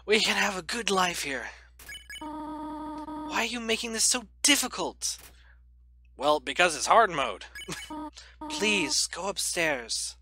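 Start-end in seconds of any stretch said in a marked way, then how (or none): 0.1-5.2 soft, motherly goat queen pleading, much softer; voicing Toriel. Reads Toriel's lines aloud. 8.5-10.1 soft, motherly goat queen pleading, much softer; voicing Toriel. Reads Toriel's lines aloud.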